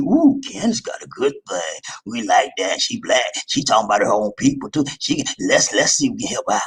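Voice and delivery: hushed scheming whisper, lightly voiced; Republicans voiced. Republicans plotting over Candace, in a hushed scheming whisper. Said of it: "They said".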